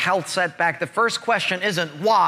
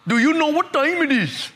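higher-pitched imitated East Indian accent; 6 semitones higher than usual. Gate man repeating question to baffled traveler, East Indian accent. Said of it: "morphed from an East Indian accent".

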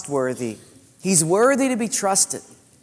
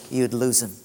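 ordinary talk, nothing marked

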